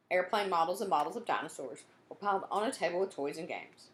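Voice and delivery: ordinary delivery, nothing marked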